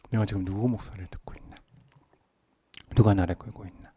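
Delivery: hushed, low searching question; whispered self-question. Acted. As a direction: ask yourself under your breath. (낮게 속삭이는 자문)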